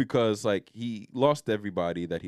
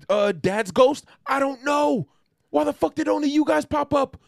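high, loud ranting screech; mock furious rant; 13 semitones higher, 5 dB louder than usual. Michelangelo yelling at his dead brothers, hammed-up screeching rage. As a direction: scream it all at the brothers.

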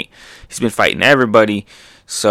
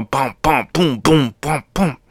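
higher, bouncy run of impact sounds; hitting noises; 4 semitones higher, slower than usual. Acted. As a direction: imitate a volley of cartoon blows. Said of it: "He's just hitting it all".

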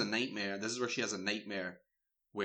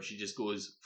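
ordinary talk, nothing marked

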